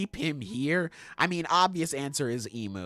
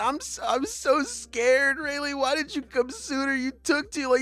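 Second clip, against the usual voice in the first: mock scared Shocky; 8 semitones higher than usual. Shocky scolding Rayleigh for coming late, high and whiny. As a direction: play her as a frightened sulking woman.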